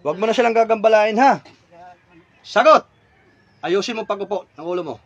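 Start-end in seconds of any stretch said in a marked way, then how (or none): 0.0-2.9 raised, loud, demanding; stern warning. Real, not acted. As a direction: warn it off forcefully. Orders spirit to leave family alone.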